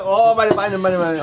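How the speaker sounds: high, loud hammed-up wail of pain; mock groaning. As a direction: whine it like a wounded man. Hammed-up pain after strong drink.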